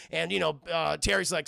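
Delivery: ordinary delivery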